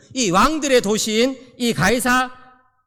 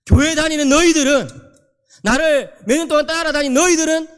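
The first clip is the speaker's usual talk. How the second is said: raised, higher-pitched pulpit challenge; forceful challenge; 6 semitones higher, 4 dB louder than usual. Acted. Preacher voices Jesus challenging his own followers, emphatic and loud. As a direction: confront the listeners directly, voice lifted.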